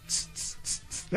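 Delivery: unvoiced spray tss sounds; imitated spray bottle hissing; spray hiss imitation. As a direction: mimic a disinfectant spray.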